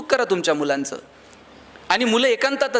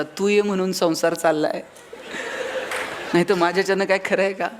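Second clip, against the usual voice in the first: filmy hero dialogue; 4 semitones lower than usual. Retold or imitated. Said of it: "एक dialogue मारायचा".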